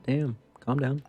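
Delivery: ordinary delivery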